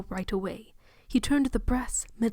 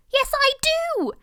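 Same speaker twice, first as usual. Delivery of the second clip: much higher and slow, shrieked at the top of the voice; screech; 5 dB louder, slower than usual. Acted. Aunt defending evolution against the preacher, shrill screech. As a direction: screech it in outrage. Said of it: "Aunt Petunia screeched".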